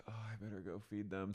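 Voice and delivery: quiet. Acted. Story voicing sleepy mom.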